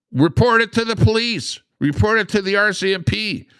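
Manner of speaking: high, loud mock police appeal; mock urging. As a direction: parody an eager hotline ad.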